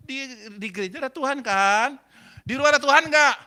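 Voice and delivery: high acted crowing, rising to a shout; loud argumentative challenge. Husband arguing he can skip church, loud and challenging. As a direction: press the point home loudly. Husband argues his point triumphantly.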